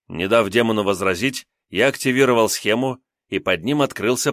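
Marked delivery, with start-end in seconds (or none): none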